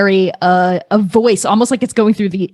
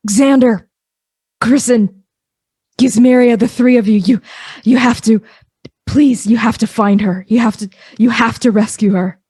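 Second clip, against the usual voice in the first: raised, pleading call; Grist calling out; 2 semitones higher than usual. Acted. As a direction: call their names, begging for help. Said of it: "Grist's voice actually calls out your names".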